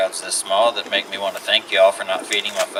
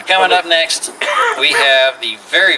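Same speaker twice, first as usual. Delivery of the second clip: announcer hype; 5 semitones higher than usual. Acted. Host announcing the headliner.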